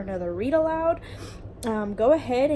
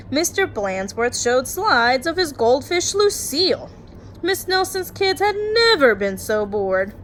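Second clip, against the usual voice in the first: lively storytime read-aloud; story narration; 5 dB louder than usual. Acted. Teacher reading a bedtime picture book to her class, lively storytime voice.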